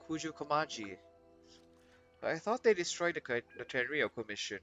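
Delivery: normal talking voice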